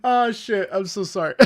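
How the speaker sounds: normal talking voice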